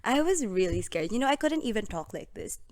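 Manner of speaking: ordinary talk, nothing marked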